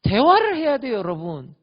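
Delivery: emphatic preacher call; exhorting. Real, not acted. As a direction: urge it at the crowd.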